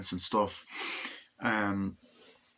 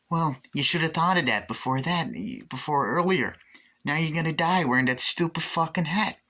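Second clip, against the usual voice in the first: mocking taunt; 8 semitones higher than usual. Taunting a dying golfer.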